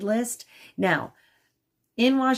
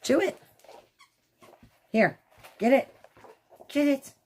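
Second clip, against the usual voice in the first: high, coaxing pet talk; urging the dog; 9 semitones higher than usual. Real, not acted. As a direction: egg the puppy on up high. Urging the puppy to play.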